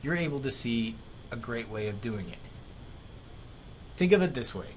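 ordinary talk

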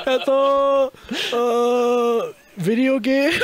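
high, loud, stretched-out fumbling; hammed-up groping for words in a foreign language; floundering. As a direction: play up the awkward stall, breaking toward laughter. Re-enacts his stumbling Japanese answer.

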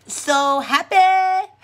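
high, loud, drawn-out delight; big playful burst of happiness; gushing happy. Real, not acted. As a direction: stretch it out with gleeful emphasis. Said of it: "You made me happy".

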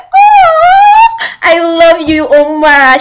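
high squealed fangirl coo; adoring gush. Pretend. Fan adores the K-drama mom, high-pitched gushing squeal.

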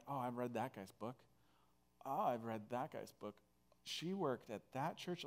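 hushed, retold awe; softly, as if thinking it in mild awe; quiet imitation of being intimidated; intimidated inner voice. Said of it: "totally one hundred percent intimidated".

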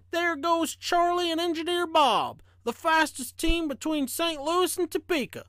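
high-pitched, wondering townsfolk voice; high storybook onlooker voice; admiring onlookers. A narrator's reading. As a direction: call it out high, admiring the passing train. Townsfolk admire passing train in story.

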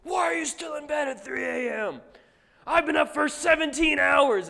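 high, strained full-volume shout; hammed-up TikTok muscle guy yelling at a viewer; screaming motivator. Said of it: "he just starts screaming at".

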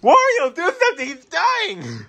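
high panicked plea for help. Acted. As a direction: call out in panic.

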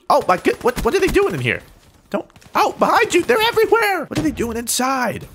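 high panicked shouting. Real, not acted. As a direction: voice jumps high in alarm. Panic as enemies appear inside.

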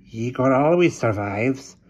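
slow, creepy hunchback servant; hunchback assistant. A narrator's reading. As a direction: speak like a sly old hunchback.

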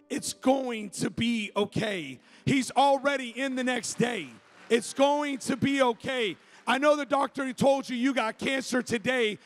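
building, emphatic sermon declaration; impassioned pulpit promise, stressing each phrase; preaching. Real, not acted. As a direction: proclaim assurance to the congregation, rising in pitch.

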